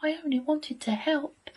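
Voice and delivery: high, wounded wolf voice; sighed. A narrator's reading. Rejected wolf who only wanted to help, sighing. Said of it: "sighed the wolf".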